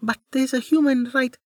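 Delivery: put-on high little girl protesting; child voice. A narrator's reading.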